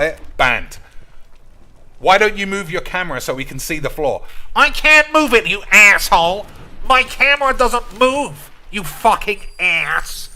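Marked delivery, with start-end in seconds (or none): 4.6-10.3 high, loud mock outrage at chat; mock angry yell. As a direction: shout it like a hammed-up tantrum. Yelling back at chat.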